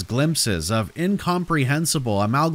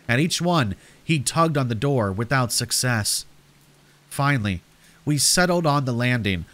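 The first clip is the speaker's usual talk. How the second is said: usual speaking voice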